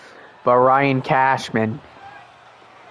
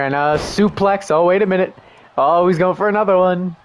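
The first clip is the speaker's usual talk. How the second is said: ordinary talk, nothing marked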